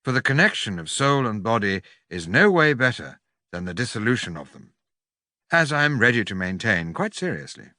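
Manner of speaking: usual speaking voice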